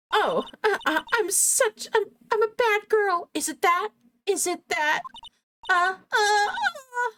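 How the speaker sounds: high, whimpering fake sobs; hammed-up crocodile tears; badly faked high sobbing; mock crying.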